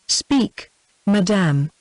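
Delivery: ordinary delivery, nothing marked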